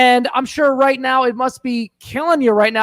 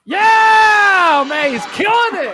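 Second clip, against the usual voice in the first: excited shout; 7 semitones higher, much slower than usual. Real, not acted. Host cheers correct answer.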